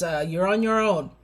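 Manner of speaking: normal talking voice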